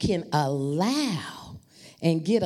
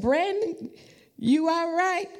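high anxious call across the house; a much higher, worried mom voice; 13 semitones higher than usual. Retold or imitated. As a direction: call out to check on him. Calling worriedly to son.

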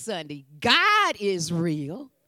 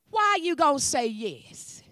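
normal talking voice

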